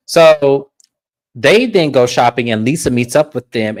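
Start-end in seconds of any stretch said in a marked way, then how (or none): none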